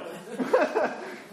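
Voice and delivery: high breathy laugh. Real, not acted. Preacher laughing at his own impression of the guy. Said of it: "laughing at own impression".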